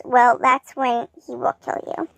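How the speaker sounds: plain reading voice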